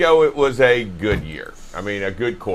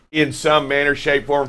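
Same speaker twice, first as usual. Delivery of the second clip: ordinary talk, nothing marked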